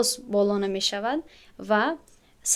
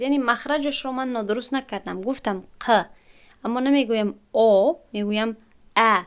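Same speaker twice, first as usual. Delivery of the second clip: normal talking voice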